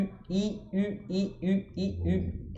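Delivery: I and U alternated, only the lips moving; alternating I and French U. Shown as a demonstration. Teacher drilling the contrast, alternating I and French U. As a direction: switch from spread I to puckered U. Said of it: "É esse som aqui".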